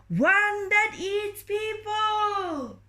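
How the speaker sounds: high, slow drawn-out shout; ferryman shouting. Acted. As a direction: call it far across the water, very high and slow. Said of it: "the ferryman shouted back".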